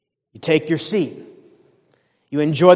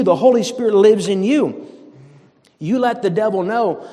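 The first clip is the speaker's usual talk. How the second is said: ordinary delivery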